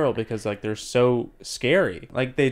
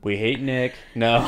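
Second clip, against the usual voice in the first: ordinary talk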